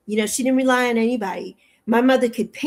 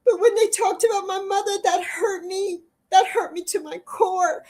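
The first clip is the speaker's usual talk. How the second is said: real crying, much higher, voice breaking; 7 semitones higher than usual. Real, not acted. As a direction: sob out the hurt about her mother. Hurt by attacks on her mother.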